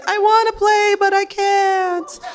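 high, slow whiny little voice; voicing the penis. High, slow whine for the limp dick. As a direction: whine it like a sad small thing. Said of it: "it's just like".

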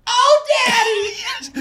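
drawn-out high mock scream. Fan mimicking the singer in a song break, long high scream. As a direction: imitate a screamed ad-lib, stretched very slow.

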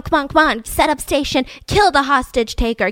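ordinary delivery

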